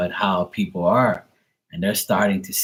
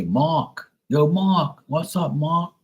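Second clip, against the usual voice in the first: put-on higher, R-less "Mark" in a Bermudian accent; Bermudian greeting; 6 semitones higher, much slower than usual. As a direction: call out "Mak" Bermudian style. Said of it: "they say".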